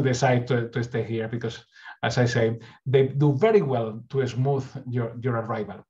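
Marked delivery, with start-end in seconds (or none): none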